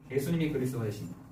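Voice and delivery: low, soft, quick repeat; subdued confession, dropped in pitch; hushed restatement. Acted. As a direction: say it back quietly, almost to yourself.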